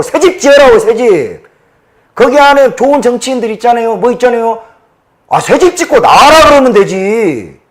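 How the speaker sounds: loud, high, heated tirade; furious ranting. Real, not acted. Man ranting to viewers about his party, furious shouting. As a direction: shout your outrage at the party. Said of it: "제가 막 흥분을 해서".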